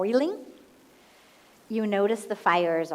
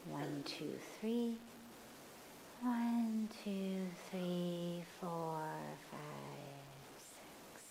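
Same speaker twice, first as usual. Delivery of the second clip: counting softer and softer down to a breath; guided breath count; 3 semitones lower, 14 dB quieter, much slower than usual. Shown as a demonstration. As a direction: fade the count into a whisper. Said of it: "inhale on three, exhale on six".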